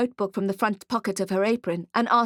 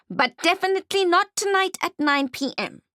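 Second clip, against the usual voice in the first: much higher goblin voice; 9 semitones higher than usual. Acted. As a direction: blurt it out as the goblin. Goblin slips the truth.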